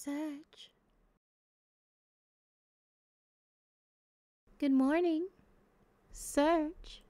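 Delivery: high, soft character voice calling someone awake; gentle wake-up call. Acted.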